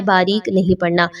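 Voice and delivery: ordinary talk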